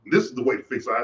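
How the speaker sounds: usual speaking voice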